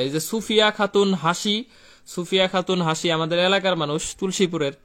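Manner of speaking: ordinary talk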